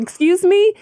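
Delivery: indignant exclamation. Retold or imitated. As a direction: catch him red-handed.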